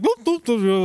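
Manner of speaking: high put-on "boop" sounds; mock boop noises. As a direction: mimic someone toddling off with high little boops. Imitates customer going off to sit.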